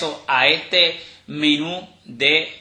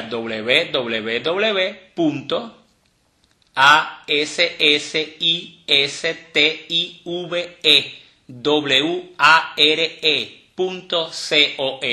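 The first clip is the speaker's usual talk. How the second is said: each letter of the web address spelled out slowly and separately; spelling letter by letter; much slower than usual. Shown as a demonstration. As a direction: spell it out one slow letter at a time for listeners. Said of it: "le voy a deletrear ahora completamente". (deletreando letra por letra, muy despacio)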